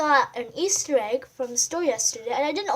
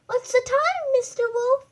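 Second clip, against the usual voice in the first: much higher mouse squeak; squeaky mouse; 11 semitones higher, 4 dB louder than usual. Acted. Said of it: "squeaks the mouse".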